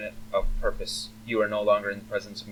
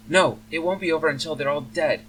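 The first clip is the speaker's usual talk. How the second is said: high, louder distressed protest; shuddering elf daughter; 7 semitones higher, 6 dB louder than usual. Acted. Elf daughter contradicts her father about the bugs, shuddering. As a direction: protest in a frightened girl's raised voice. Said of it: "she shudders".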